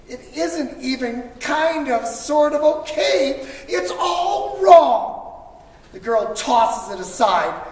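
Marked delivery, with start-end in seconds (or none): 0.1-5.6 rising from mild dismay to a laughing shout; building exasperation. A narrator's reading. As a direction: let it build until you burst out on "all wrong". Story builds to outburst of frustration.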